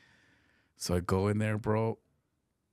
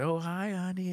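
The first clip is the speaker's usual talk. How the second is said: mock sweet greeting; 11 semitones higher than usual. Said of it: "doesn't say".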